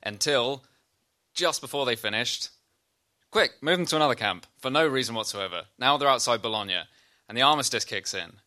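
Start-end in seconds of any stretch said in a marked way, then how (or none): 3.3-4.5 urgent order. Retold or imitated. Guards' sudden order retold.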